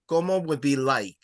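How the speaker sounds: ordinary talk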